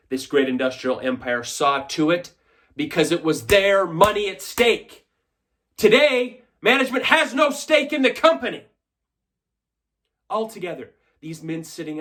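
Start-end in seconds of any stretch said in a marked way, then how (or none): 2.8-8.7 raised, higher voice ringing out; indignant oratory. Acted. Shareholder attacks management before fellow stockholders, indignant and loud. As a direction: build in pitch and volume as an accusation.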